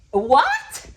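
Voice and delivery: high, loud, stunned What; shocked. Real, not acted. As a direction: blurt it out in disbelief. Shocked at sold-out tickets.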